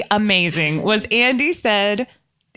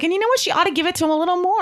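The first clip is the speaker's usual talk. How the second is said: higher, fast, eager naive voice; mock eager; 9 semitones higher, much faster than usual. Retelling her naive remark to June, mock eager. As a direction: rush it out like an eager innocent.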